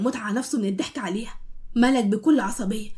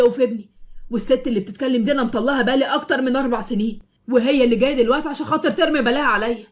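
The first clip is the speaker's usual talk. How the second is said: raised voice. Acted. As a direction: set the record straight for everyone. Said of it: "وبعلو صوته".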